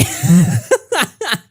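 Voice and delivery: short laugh burst. Real, not acted. After reading own cringe line, a short laugh.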